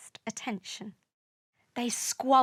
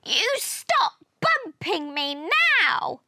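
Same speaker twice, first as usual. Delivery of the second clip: high, indignant yell; angry yell; 7 semitones higher, 3 dB louder than usual. A narrator's reading. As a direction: yell at someone bumping into you. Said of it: "Jupiter yelled".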